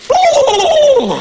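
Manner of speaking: very high, loud startled squawk; startled turkey. Retold or imitated. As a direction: voice the frightened turkey. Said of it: "the turkey just went".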